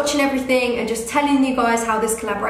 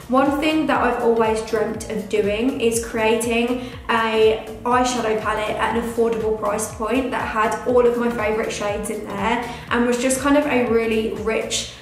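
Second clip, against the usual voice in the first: ordinary delivery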